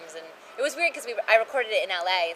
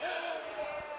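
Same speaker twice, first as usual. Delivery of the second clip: excited gasp; 9 semitones higher, 9 dB quieter than usual. Retold or imitated. I was like.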